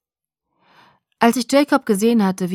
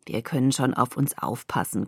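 low, soft growl; 6 semitones lower, 5 dB quieter than usual. A narrator's reading. Jacob brushes off Bella's warning, growling. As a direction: growl it quietly, low in the throat. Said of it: "knurrte er".

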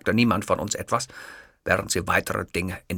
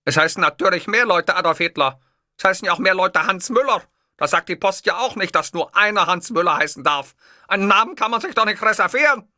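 higher, louder indignant dictator voice; Hitler lecturing; 10 semitones higher, 6 dB louder than usual. Acted. Hitler explaining names to the clerk, indignant. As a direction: lecture her like a rally crowd.

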